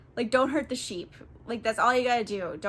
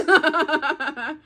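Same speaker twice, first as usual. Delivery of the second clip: laugh; 5 semitones higher, 6 dB louder than usual. Real, not acted.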